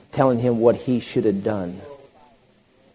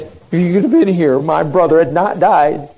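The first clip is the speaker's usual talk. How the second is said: loud, raised-pitch accusation; forceful reproach; 7 semitones higher, 8 dB louder than usual. Acted. Martha confronting Jesus over her brother's death, forceful reproach. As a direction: throw the blame at him, louder and higher. Martha's words, contrasted with Mary's.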